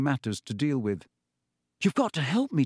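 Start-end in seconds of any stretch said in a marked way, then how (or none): 1.8-2.7 put-on higher, urgent boy's plea; pleading boy. A narrator's reading. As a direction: beg him for help, higher. Harry pleads with Riddle.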